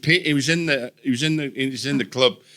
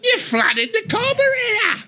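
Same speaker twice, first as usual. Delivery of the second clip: very high, strained Australian yell; slow, hammed-up shriek; imitated street shout; 4 dB louder, slower than usual. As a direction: holler it like an Aussie bloke across the street. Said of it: "a bloke in the middle of the street shouting".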